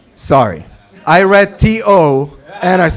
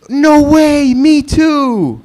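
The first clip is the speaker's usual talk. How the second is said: excited; 8 semitones higher, much slower than usual. Retold or imitated. Excited Lebanese guy in dialogue.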